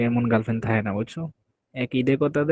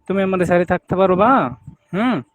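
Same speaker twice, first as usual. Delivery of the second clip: higher, louder demanding question; raised voice; 6 semitones higher, 6 dB louder than usual. Acted. Demanding an answer from her partner, loud. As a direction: push the question hard. Heated lovers' quarrel.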